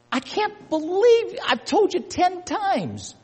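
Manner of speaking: mock outrage at a repeat offender; exasperated complaint. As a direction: play the exasperated scold, dragging it out.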